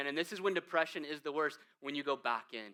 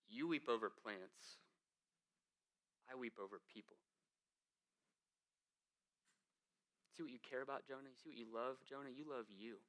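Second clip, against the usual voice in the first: gentle rebuke; 4 semitones lower, 14 dB quieter than usual. Acted. God gently showing Jonah what he loves, softly. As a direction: lay it on him quietly and kindly. Voicing God's reply to Jonah.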